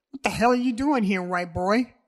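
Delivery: loud, fast, angry bellow. Acted. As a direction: bellow it at the rival. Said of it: "Davon bellowed".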